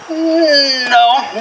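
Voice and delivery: high, drawn-out no; mock-chiding high refusal; playful refusal. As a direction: pitch it up to stop someone leaving. Stops the robber walking away.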